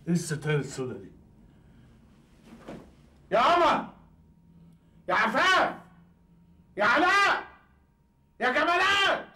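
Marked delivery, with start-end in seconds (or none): 3.3-9.3 much higher and louder, each name called out; calling out. Acted. Father calling his family loudly by name. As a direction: call each name across the empty house.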